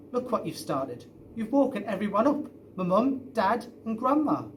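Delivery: put-on a boy scolding the dog for waking the house; exasperated telling-off. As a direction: tell the dog off, rising in alarm.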